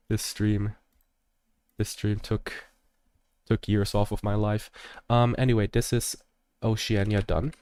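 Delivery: ordinary delivery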